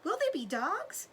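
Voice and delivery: put-on a scared little fox child; frightened cub. A narrator's reading. Cub asks his mother about dogs, frightened high voice. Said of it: "huge with fright".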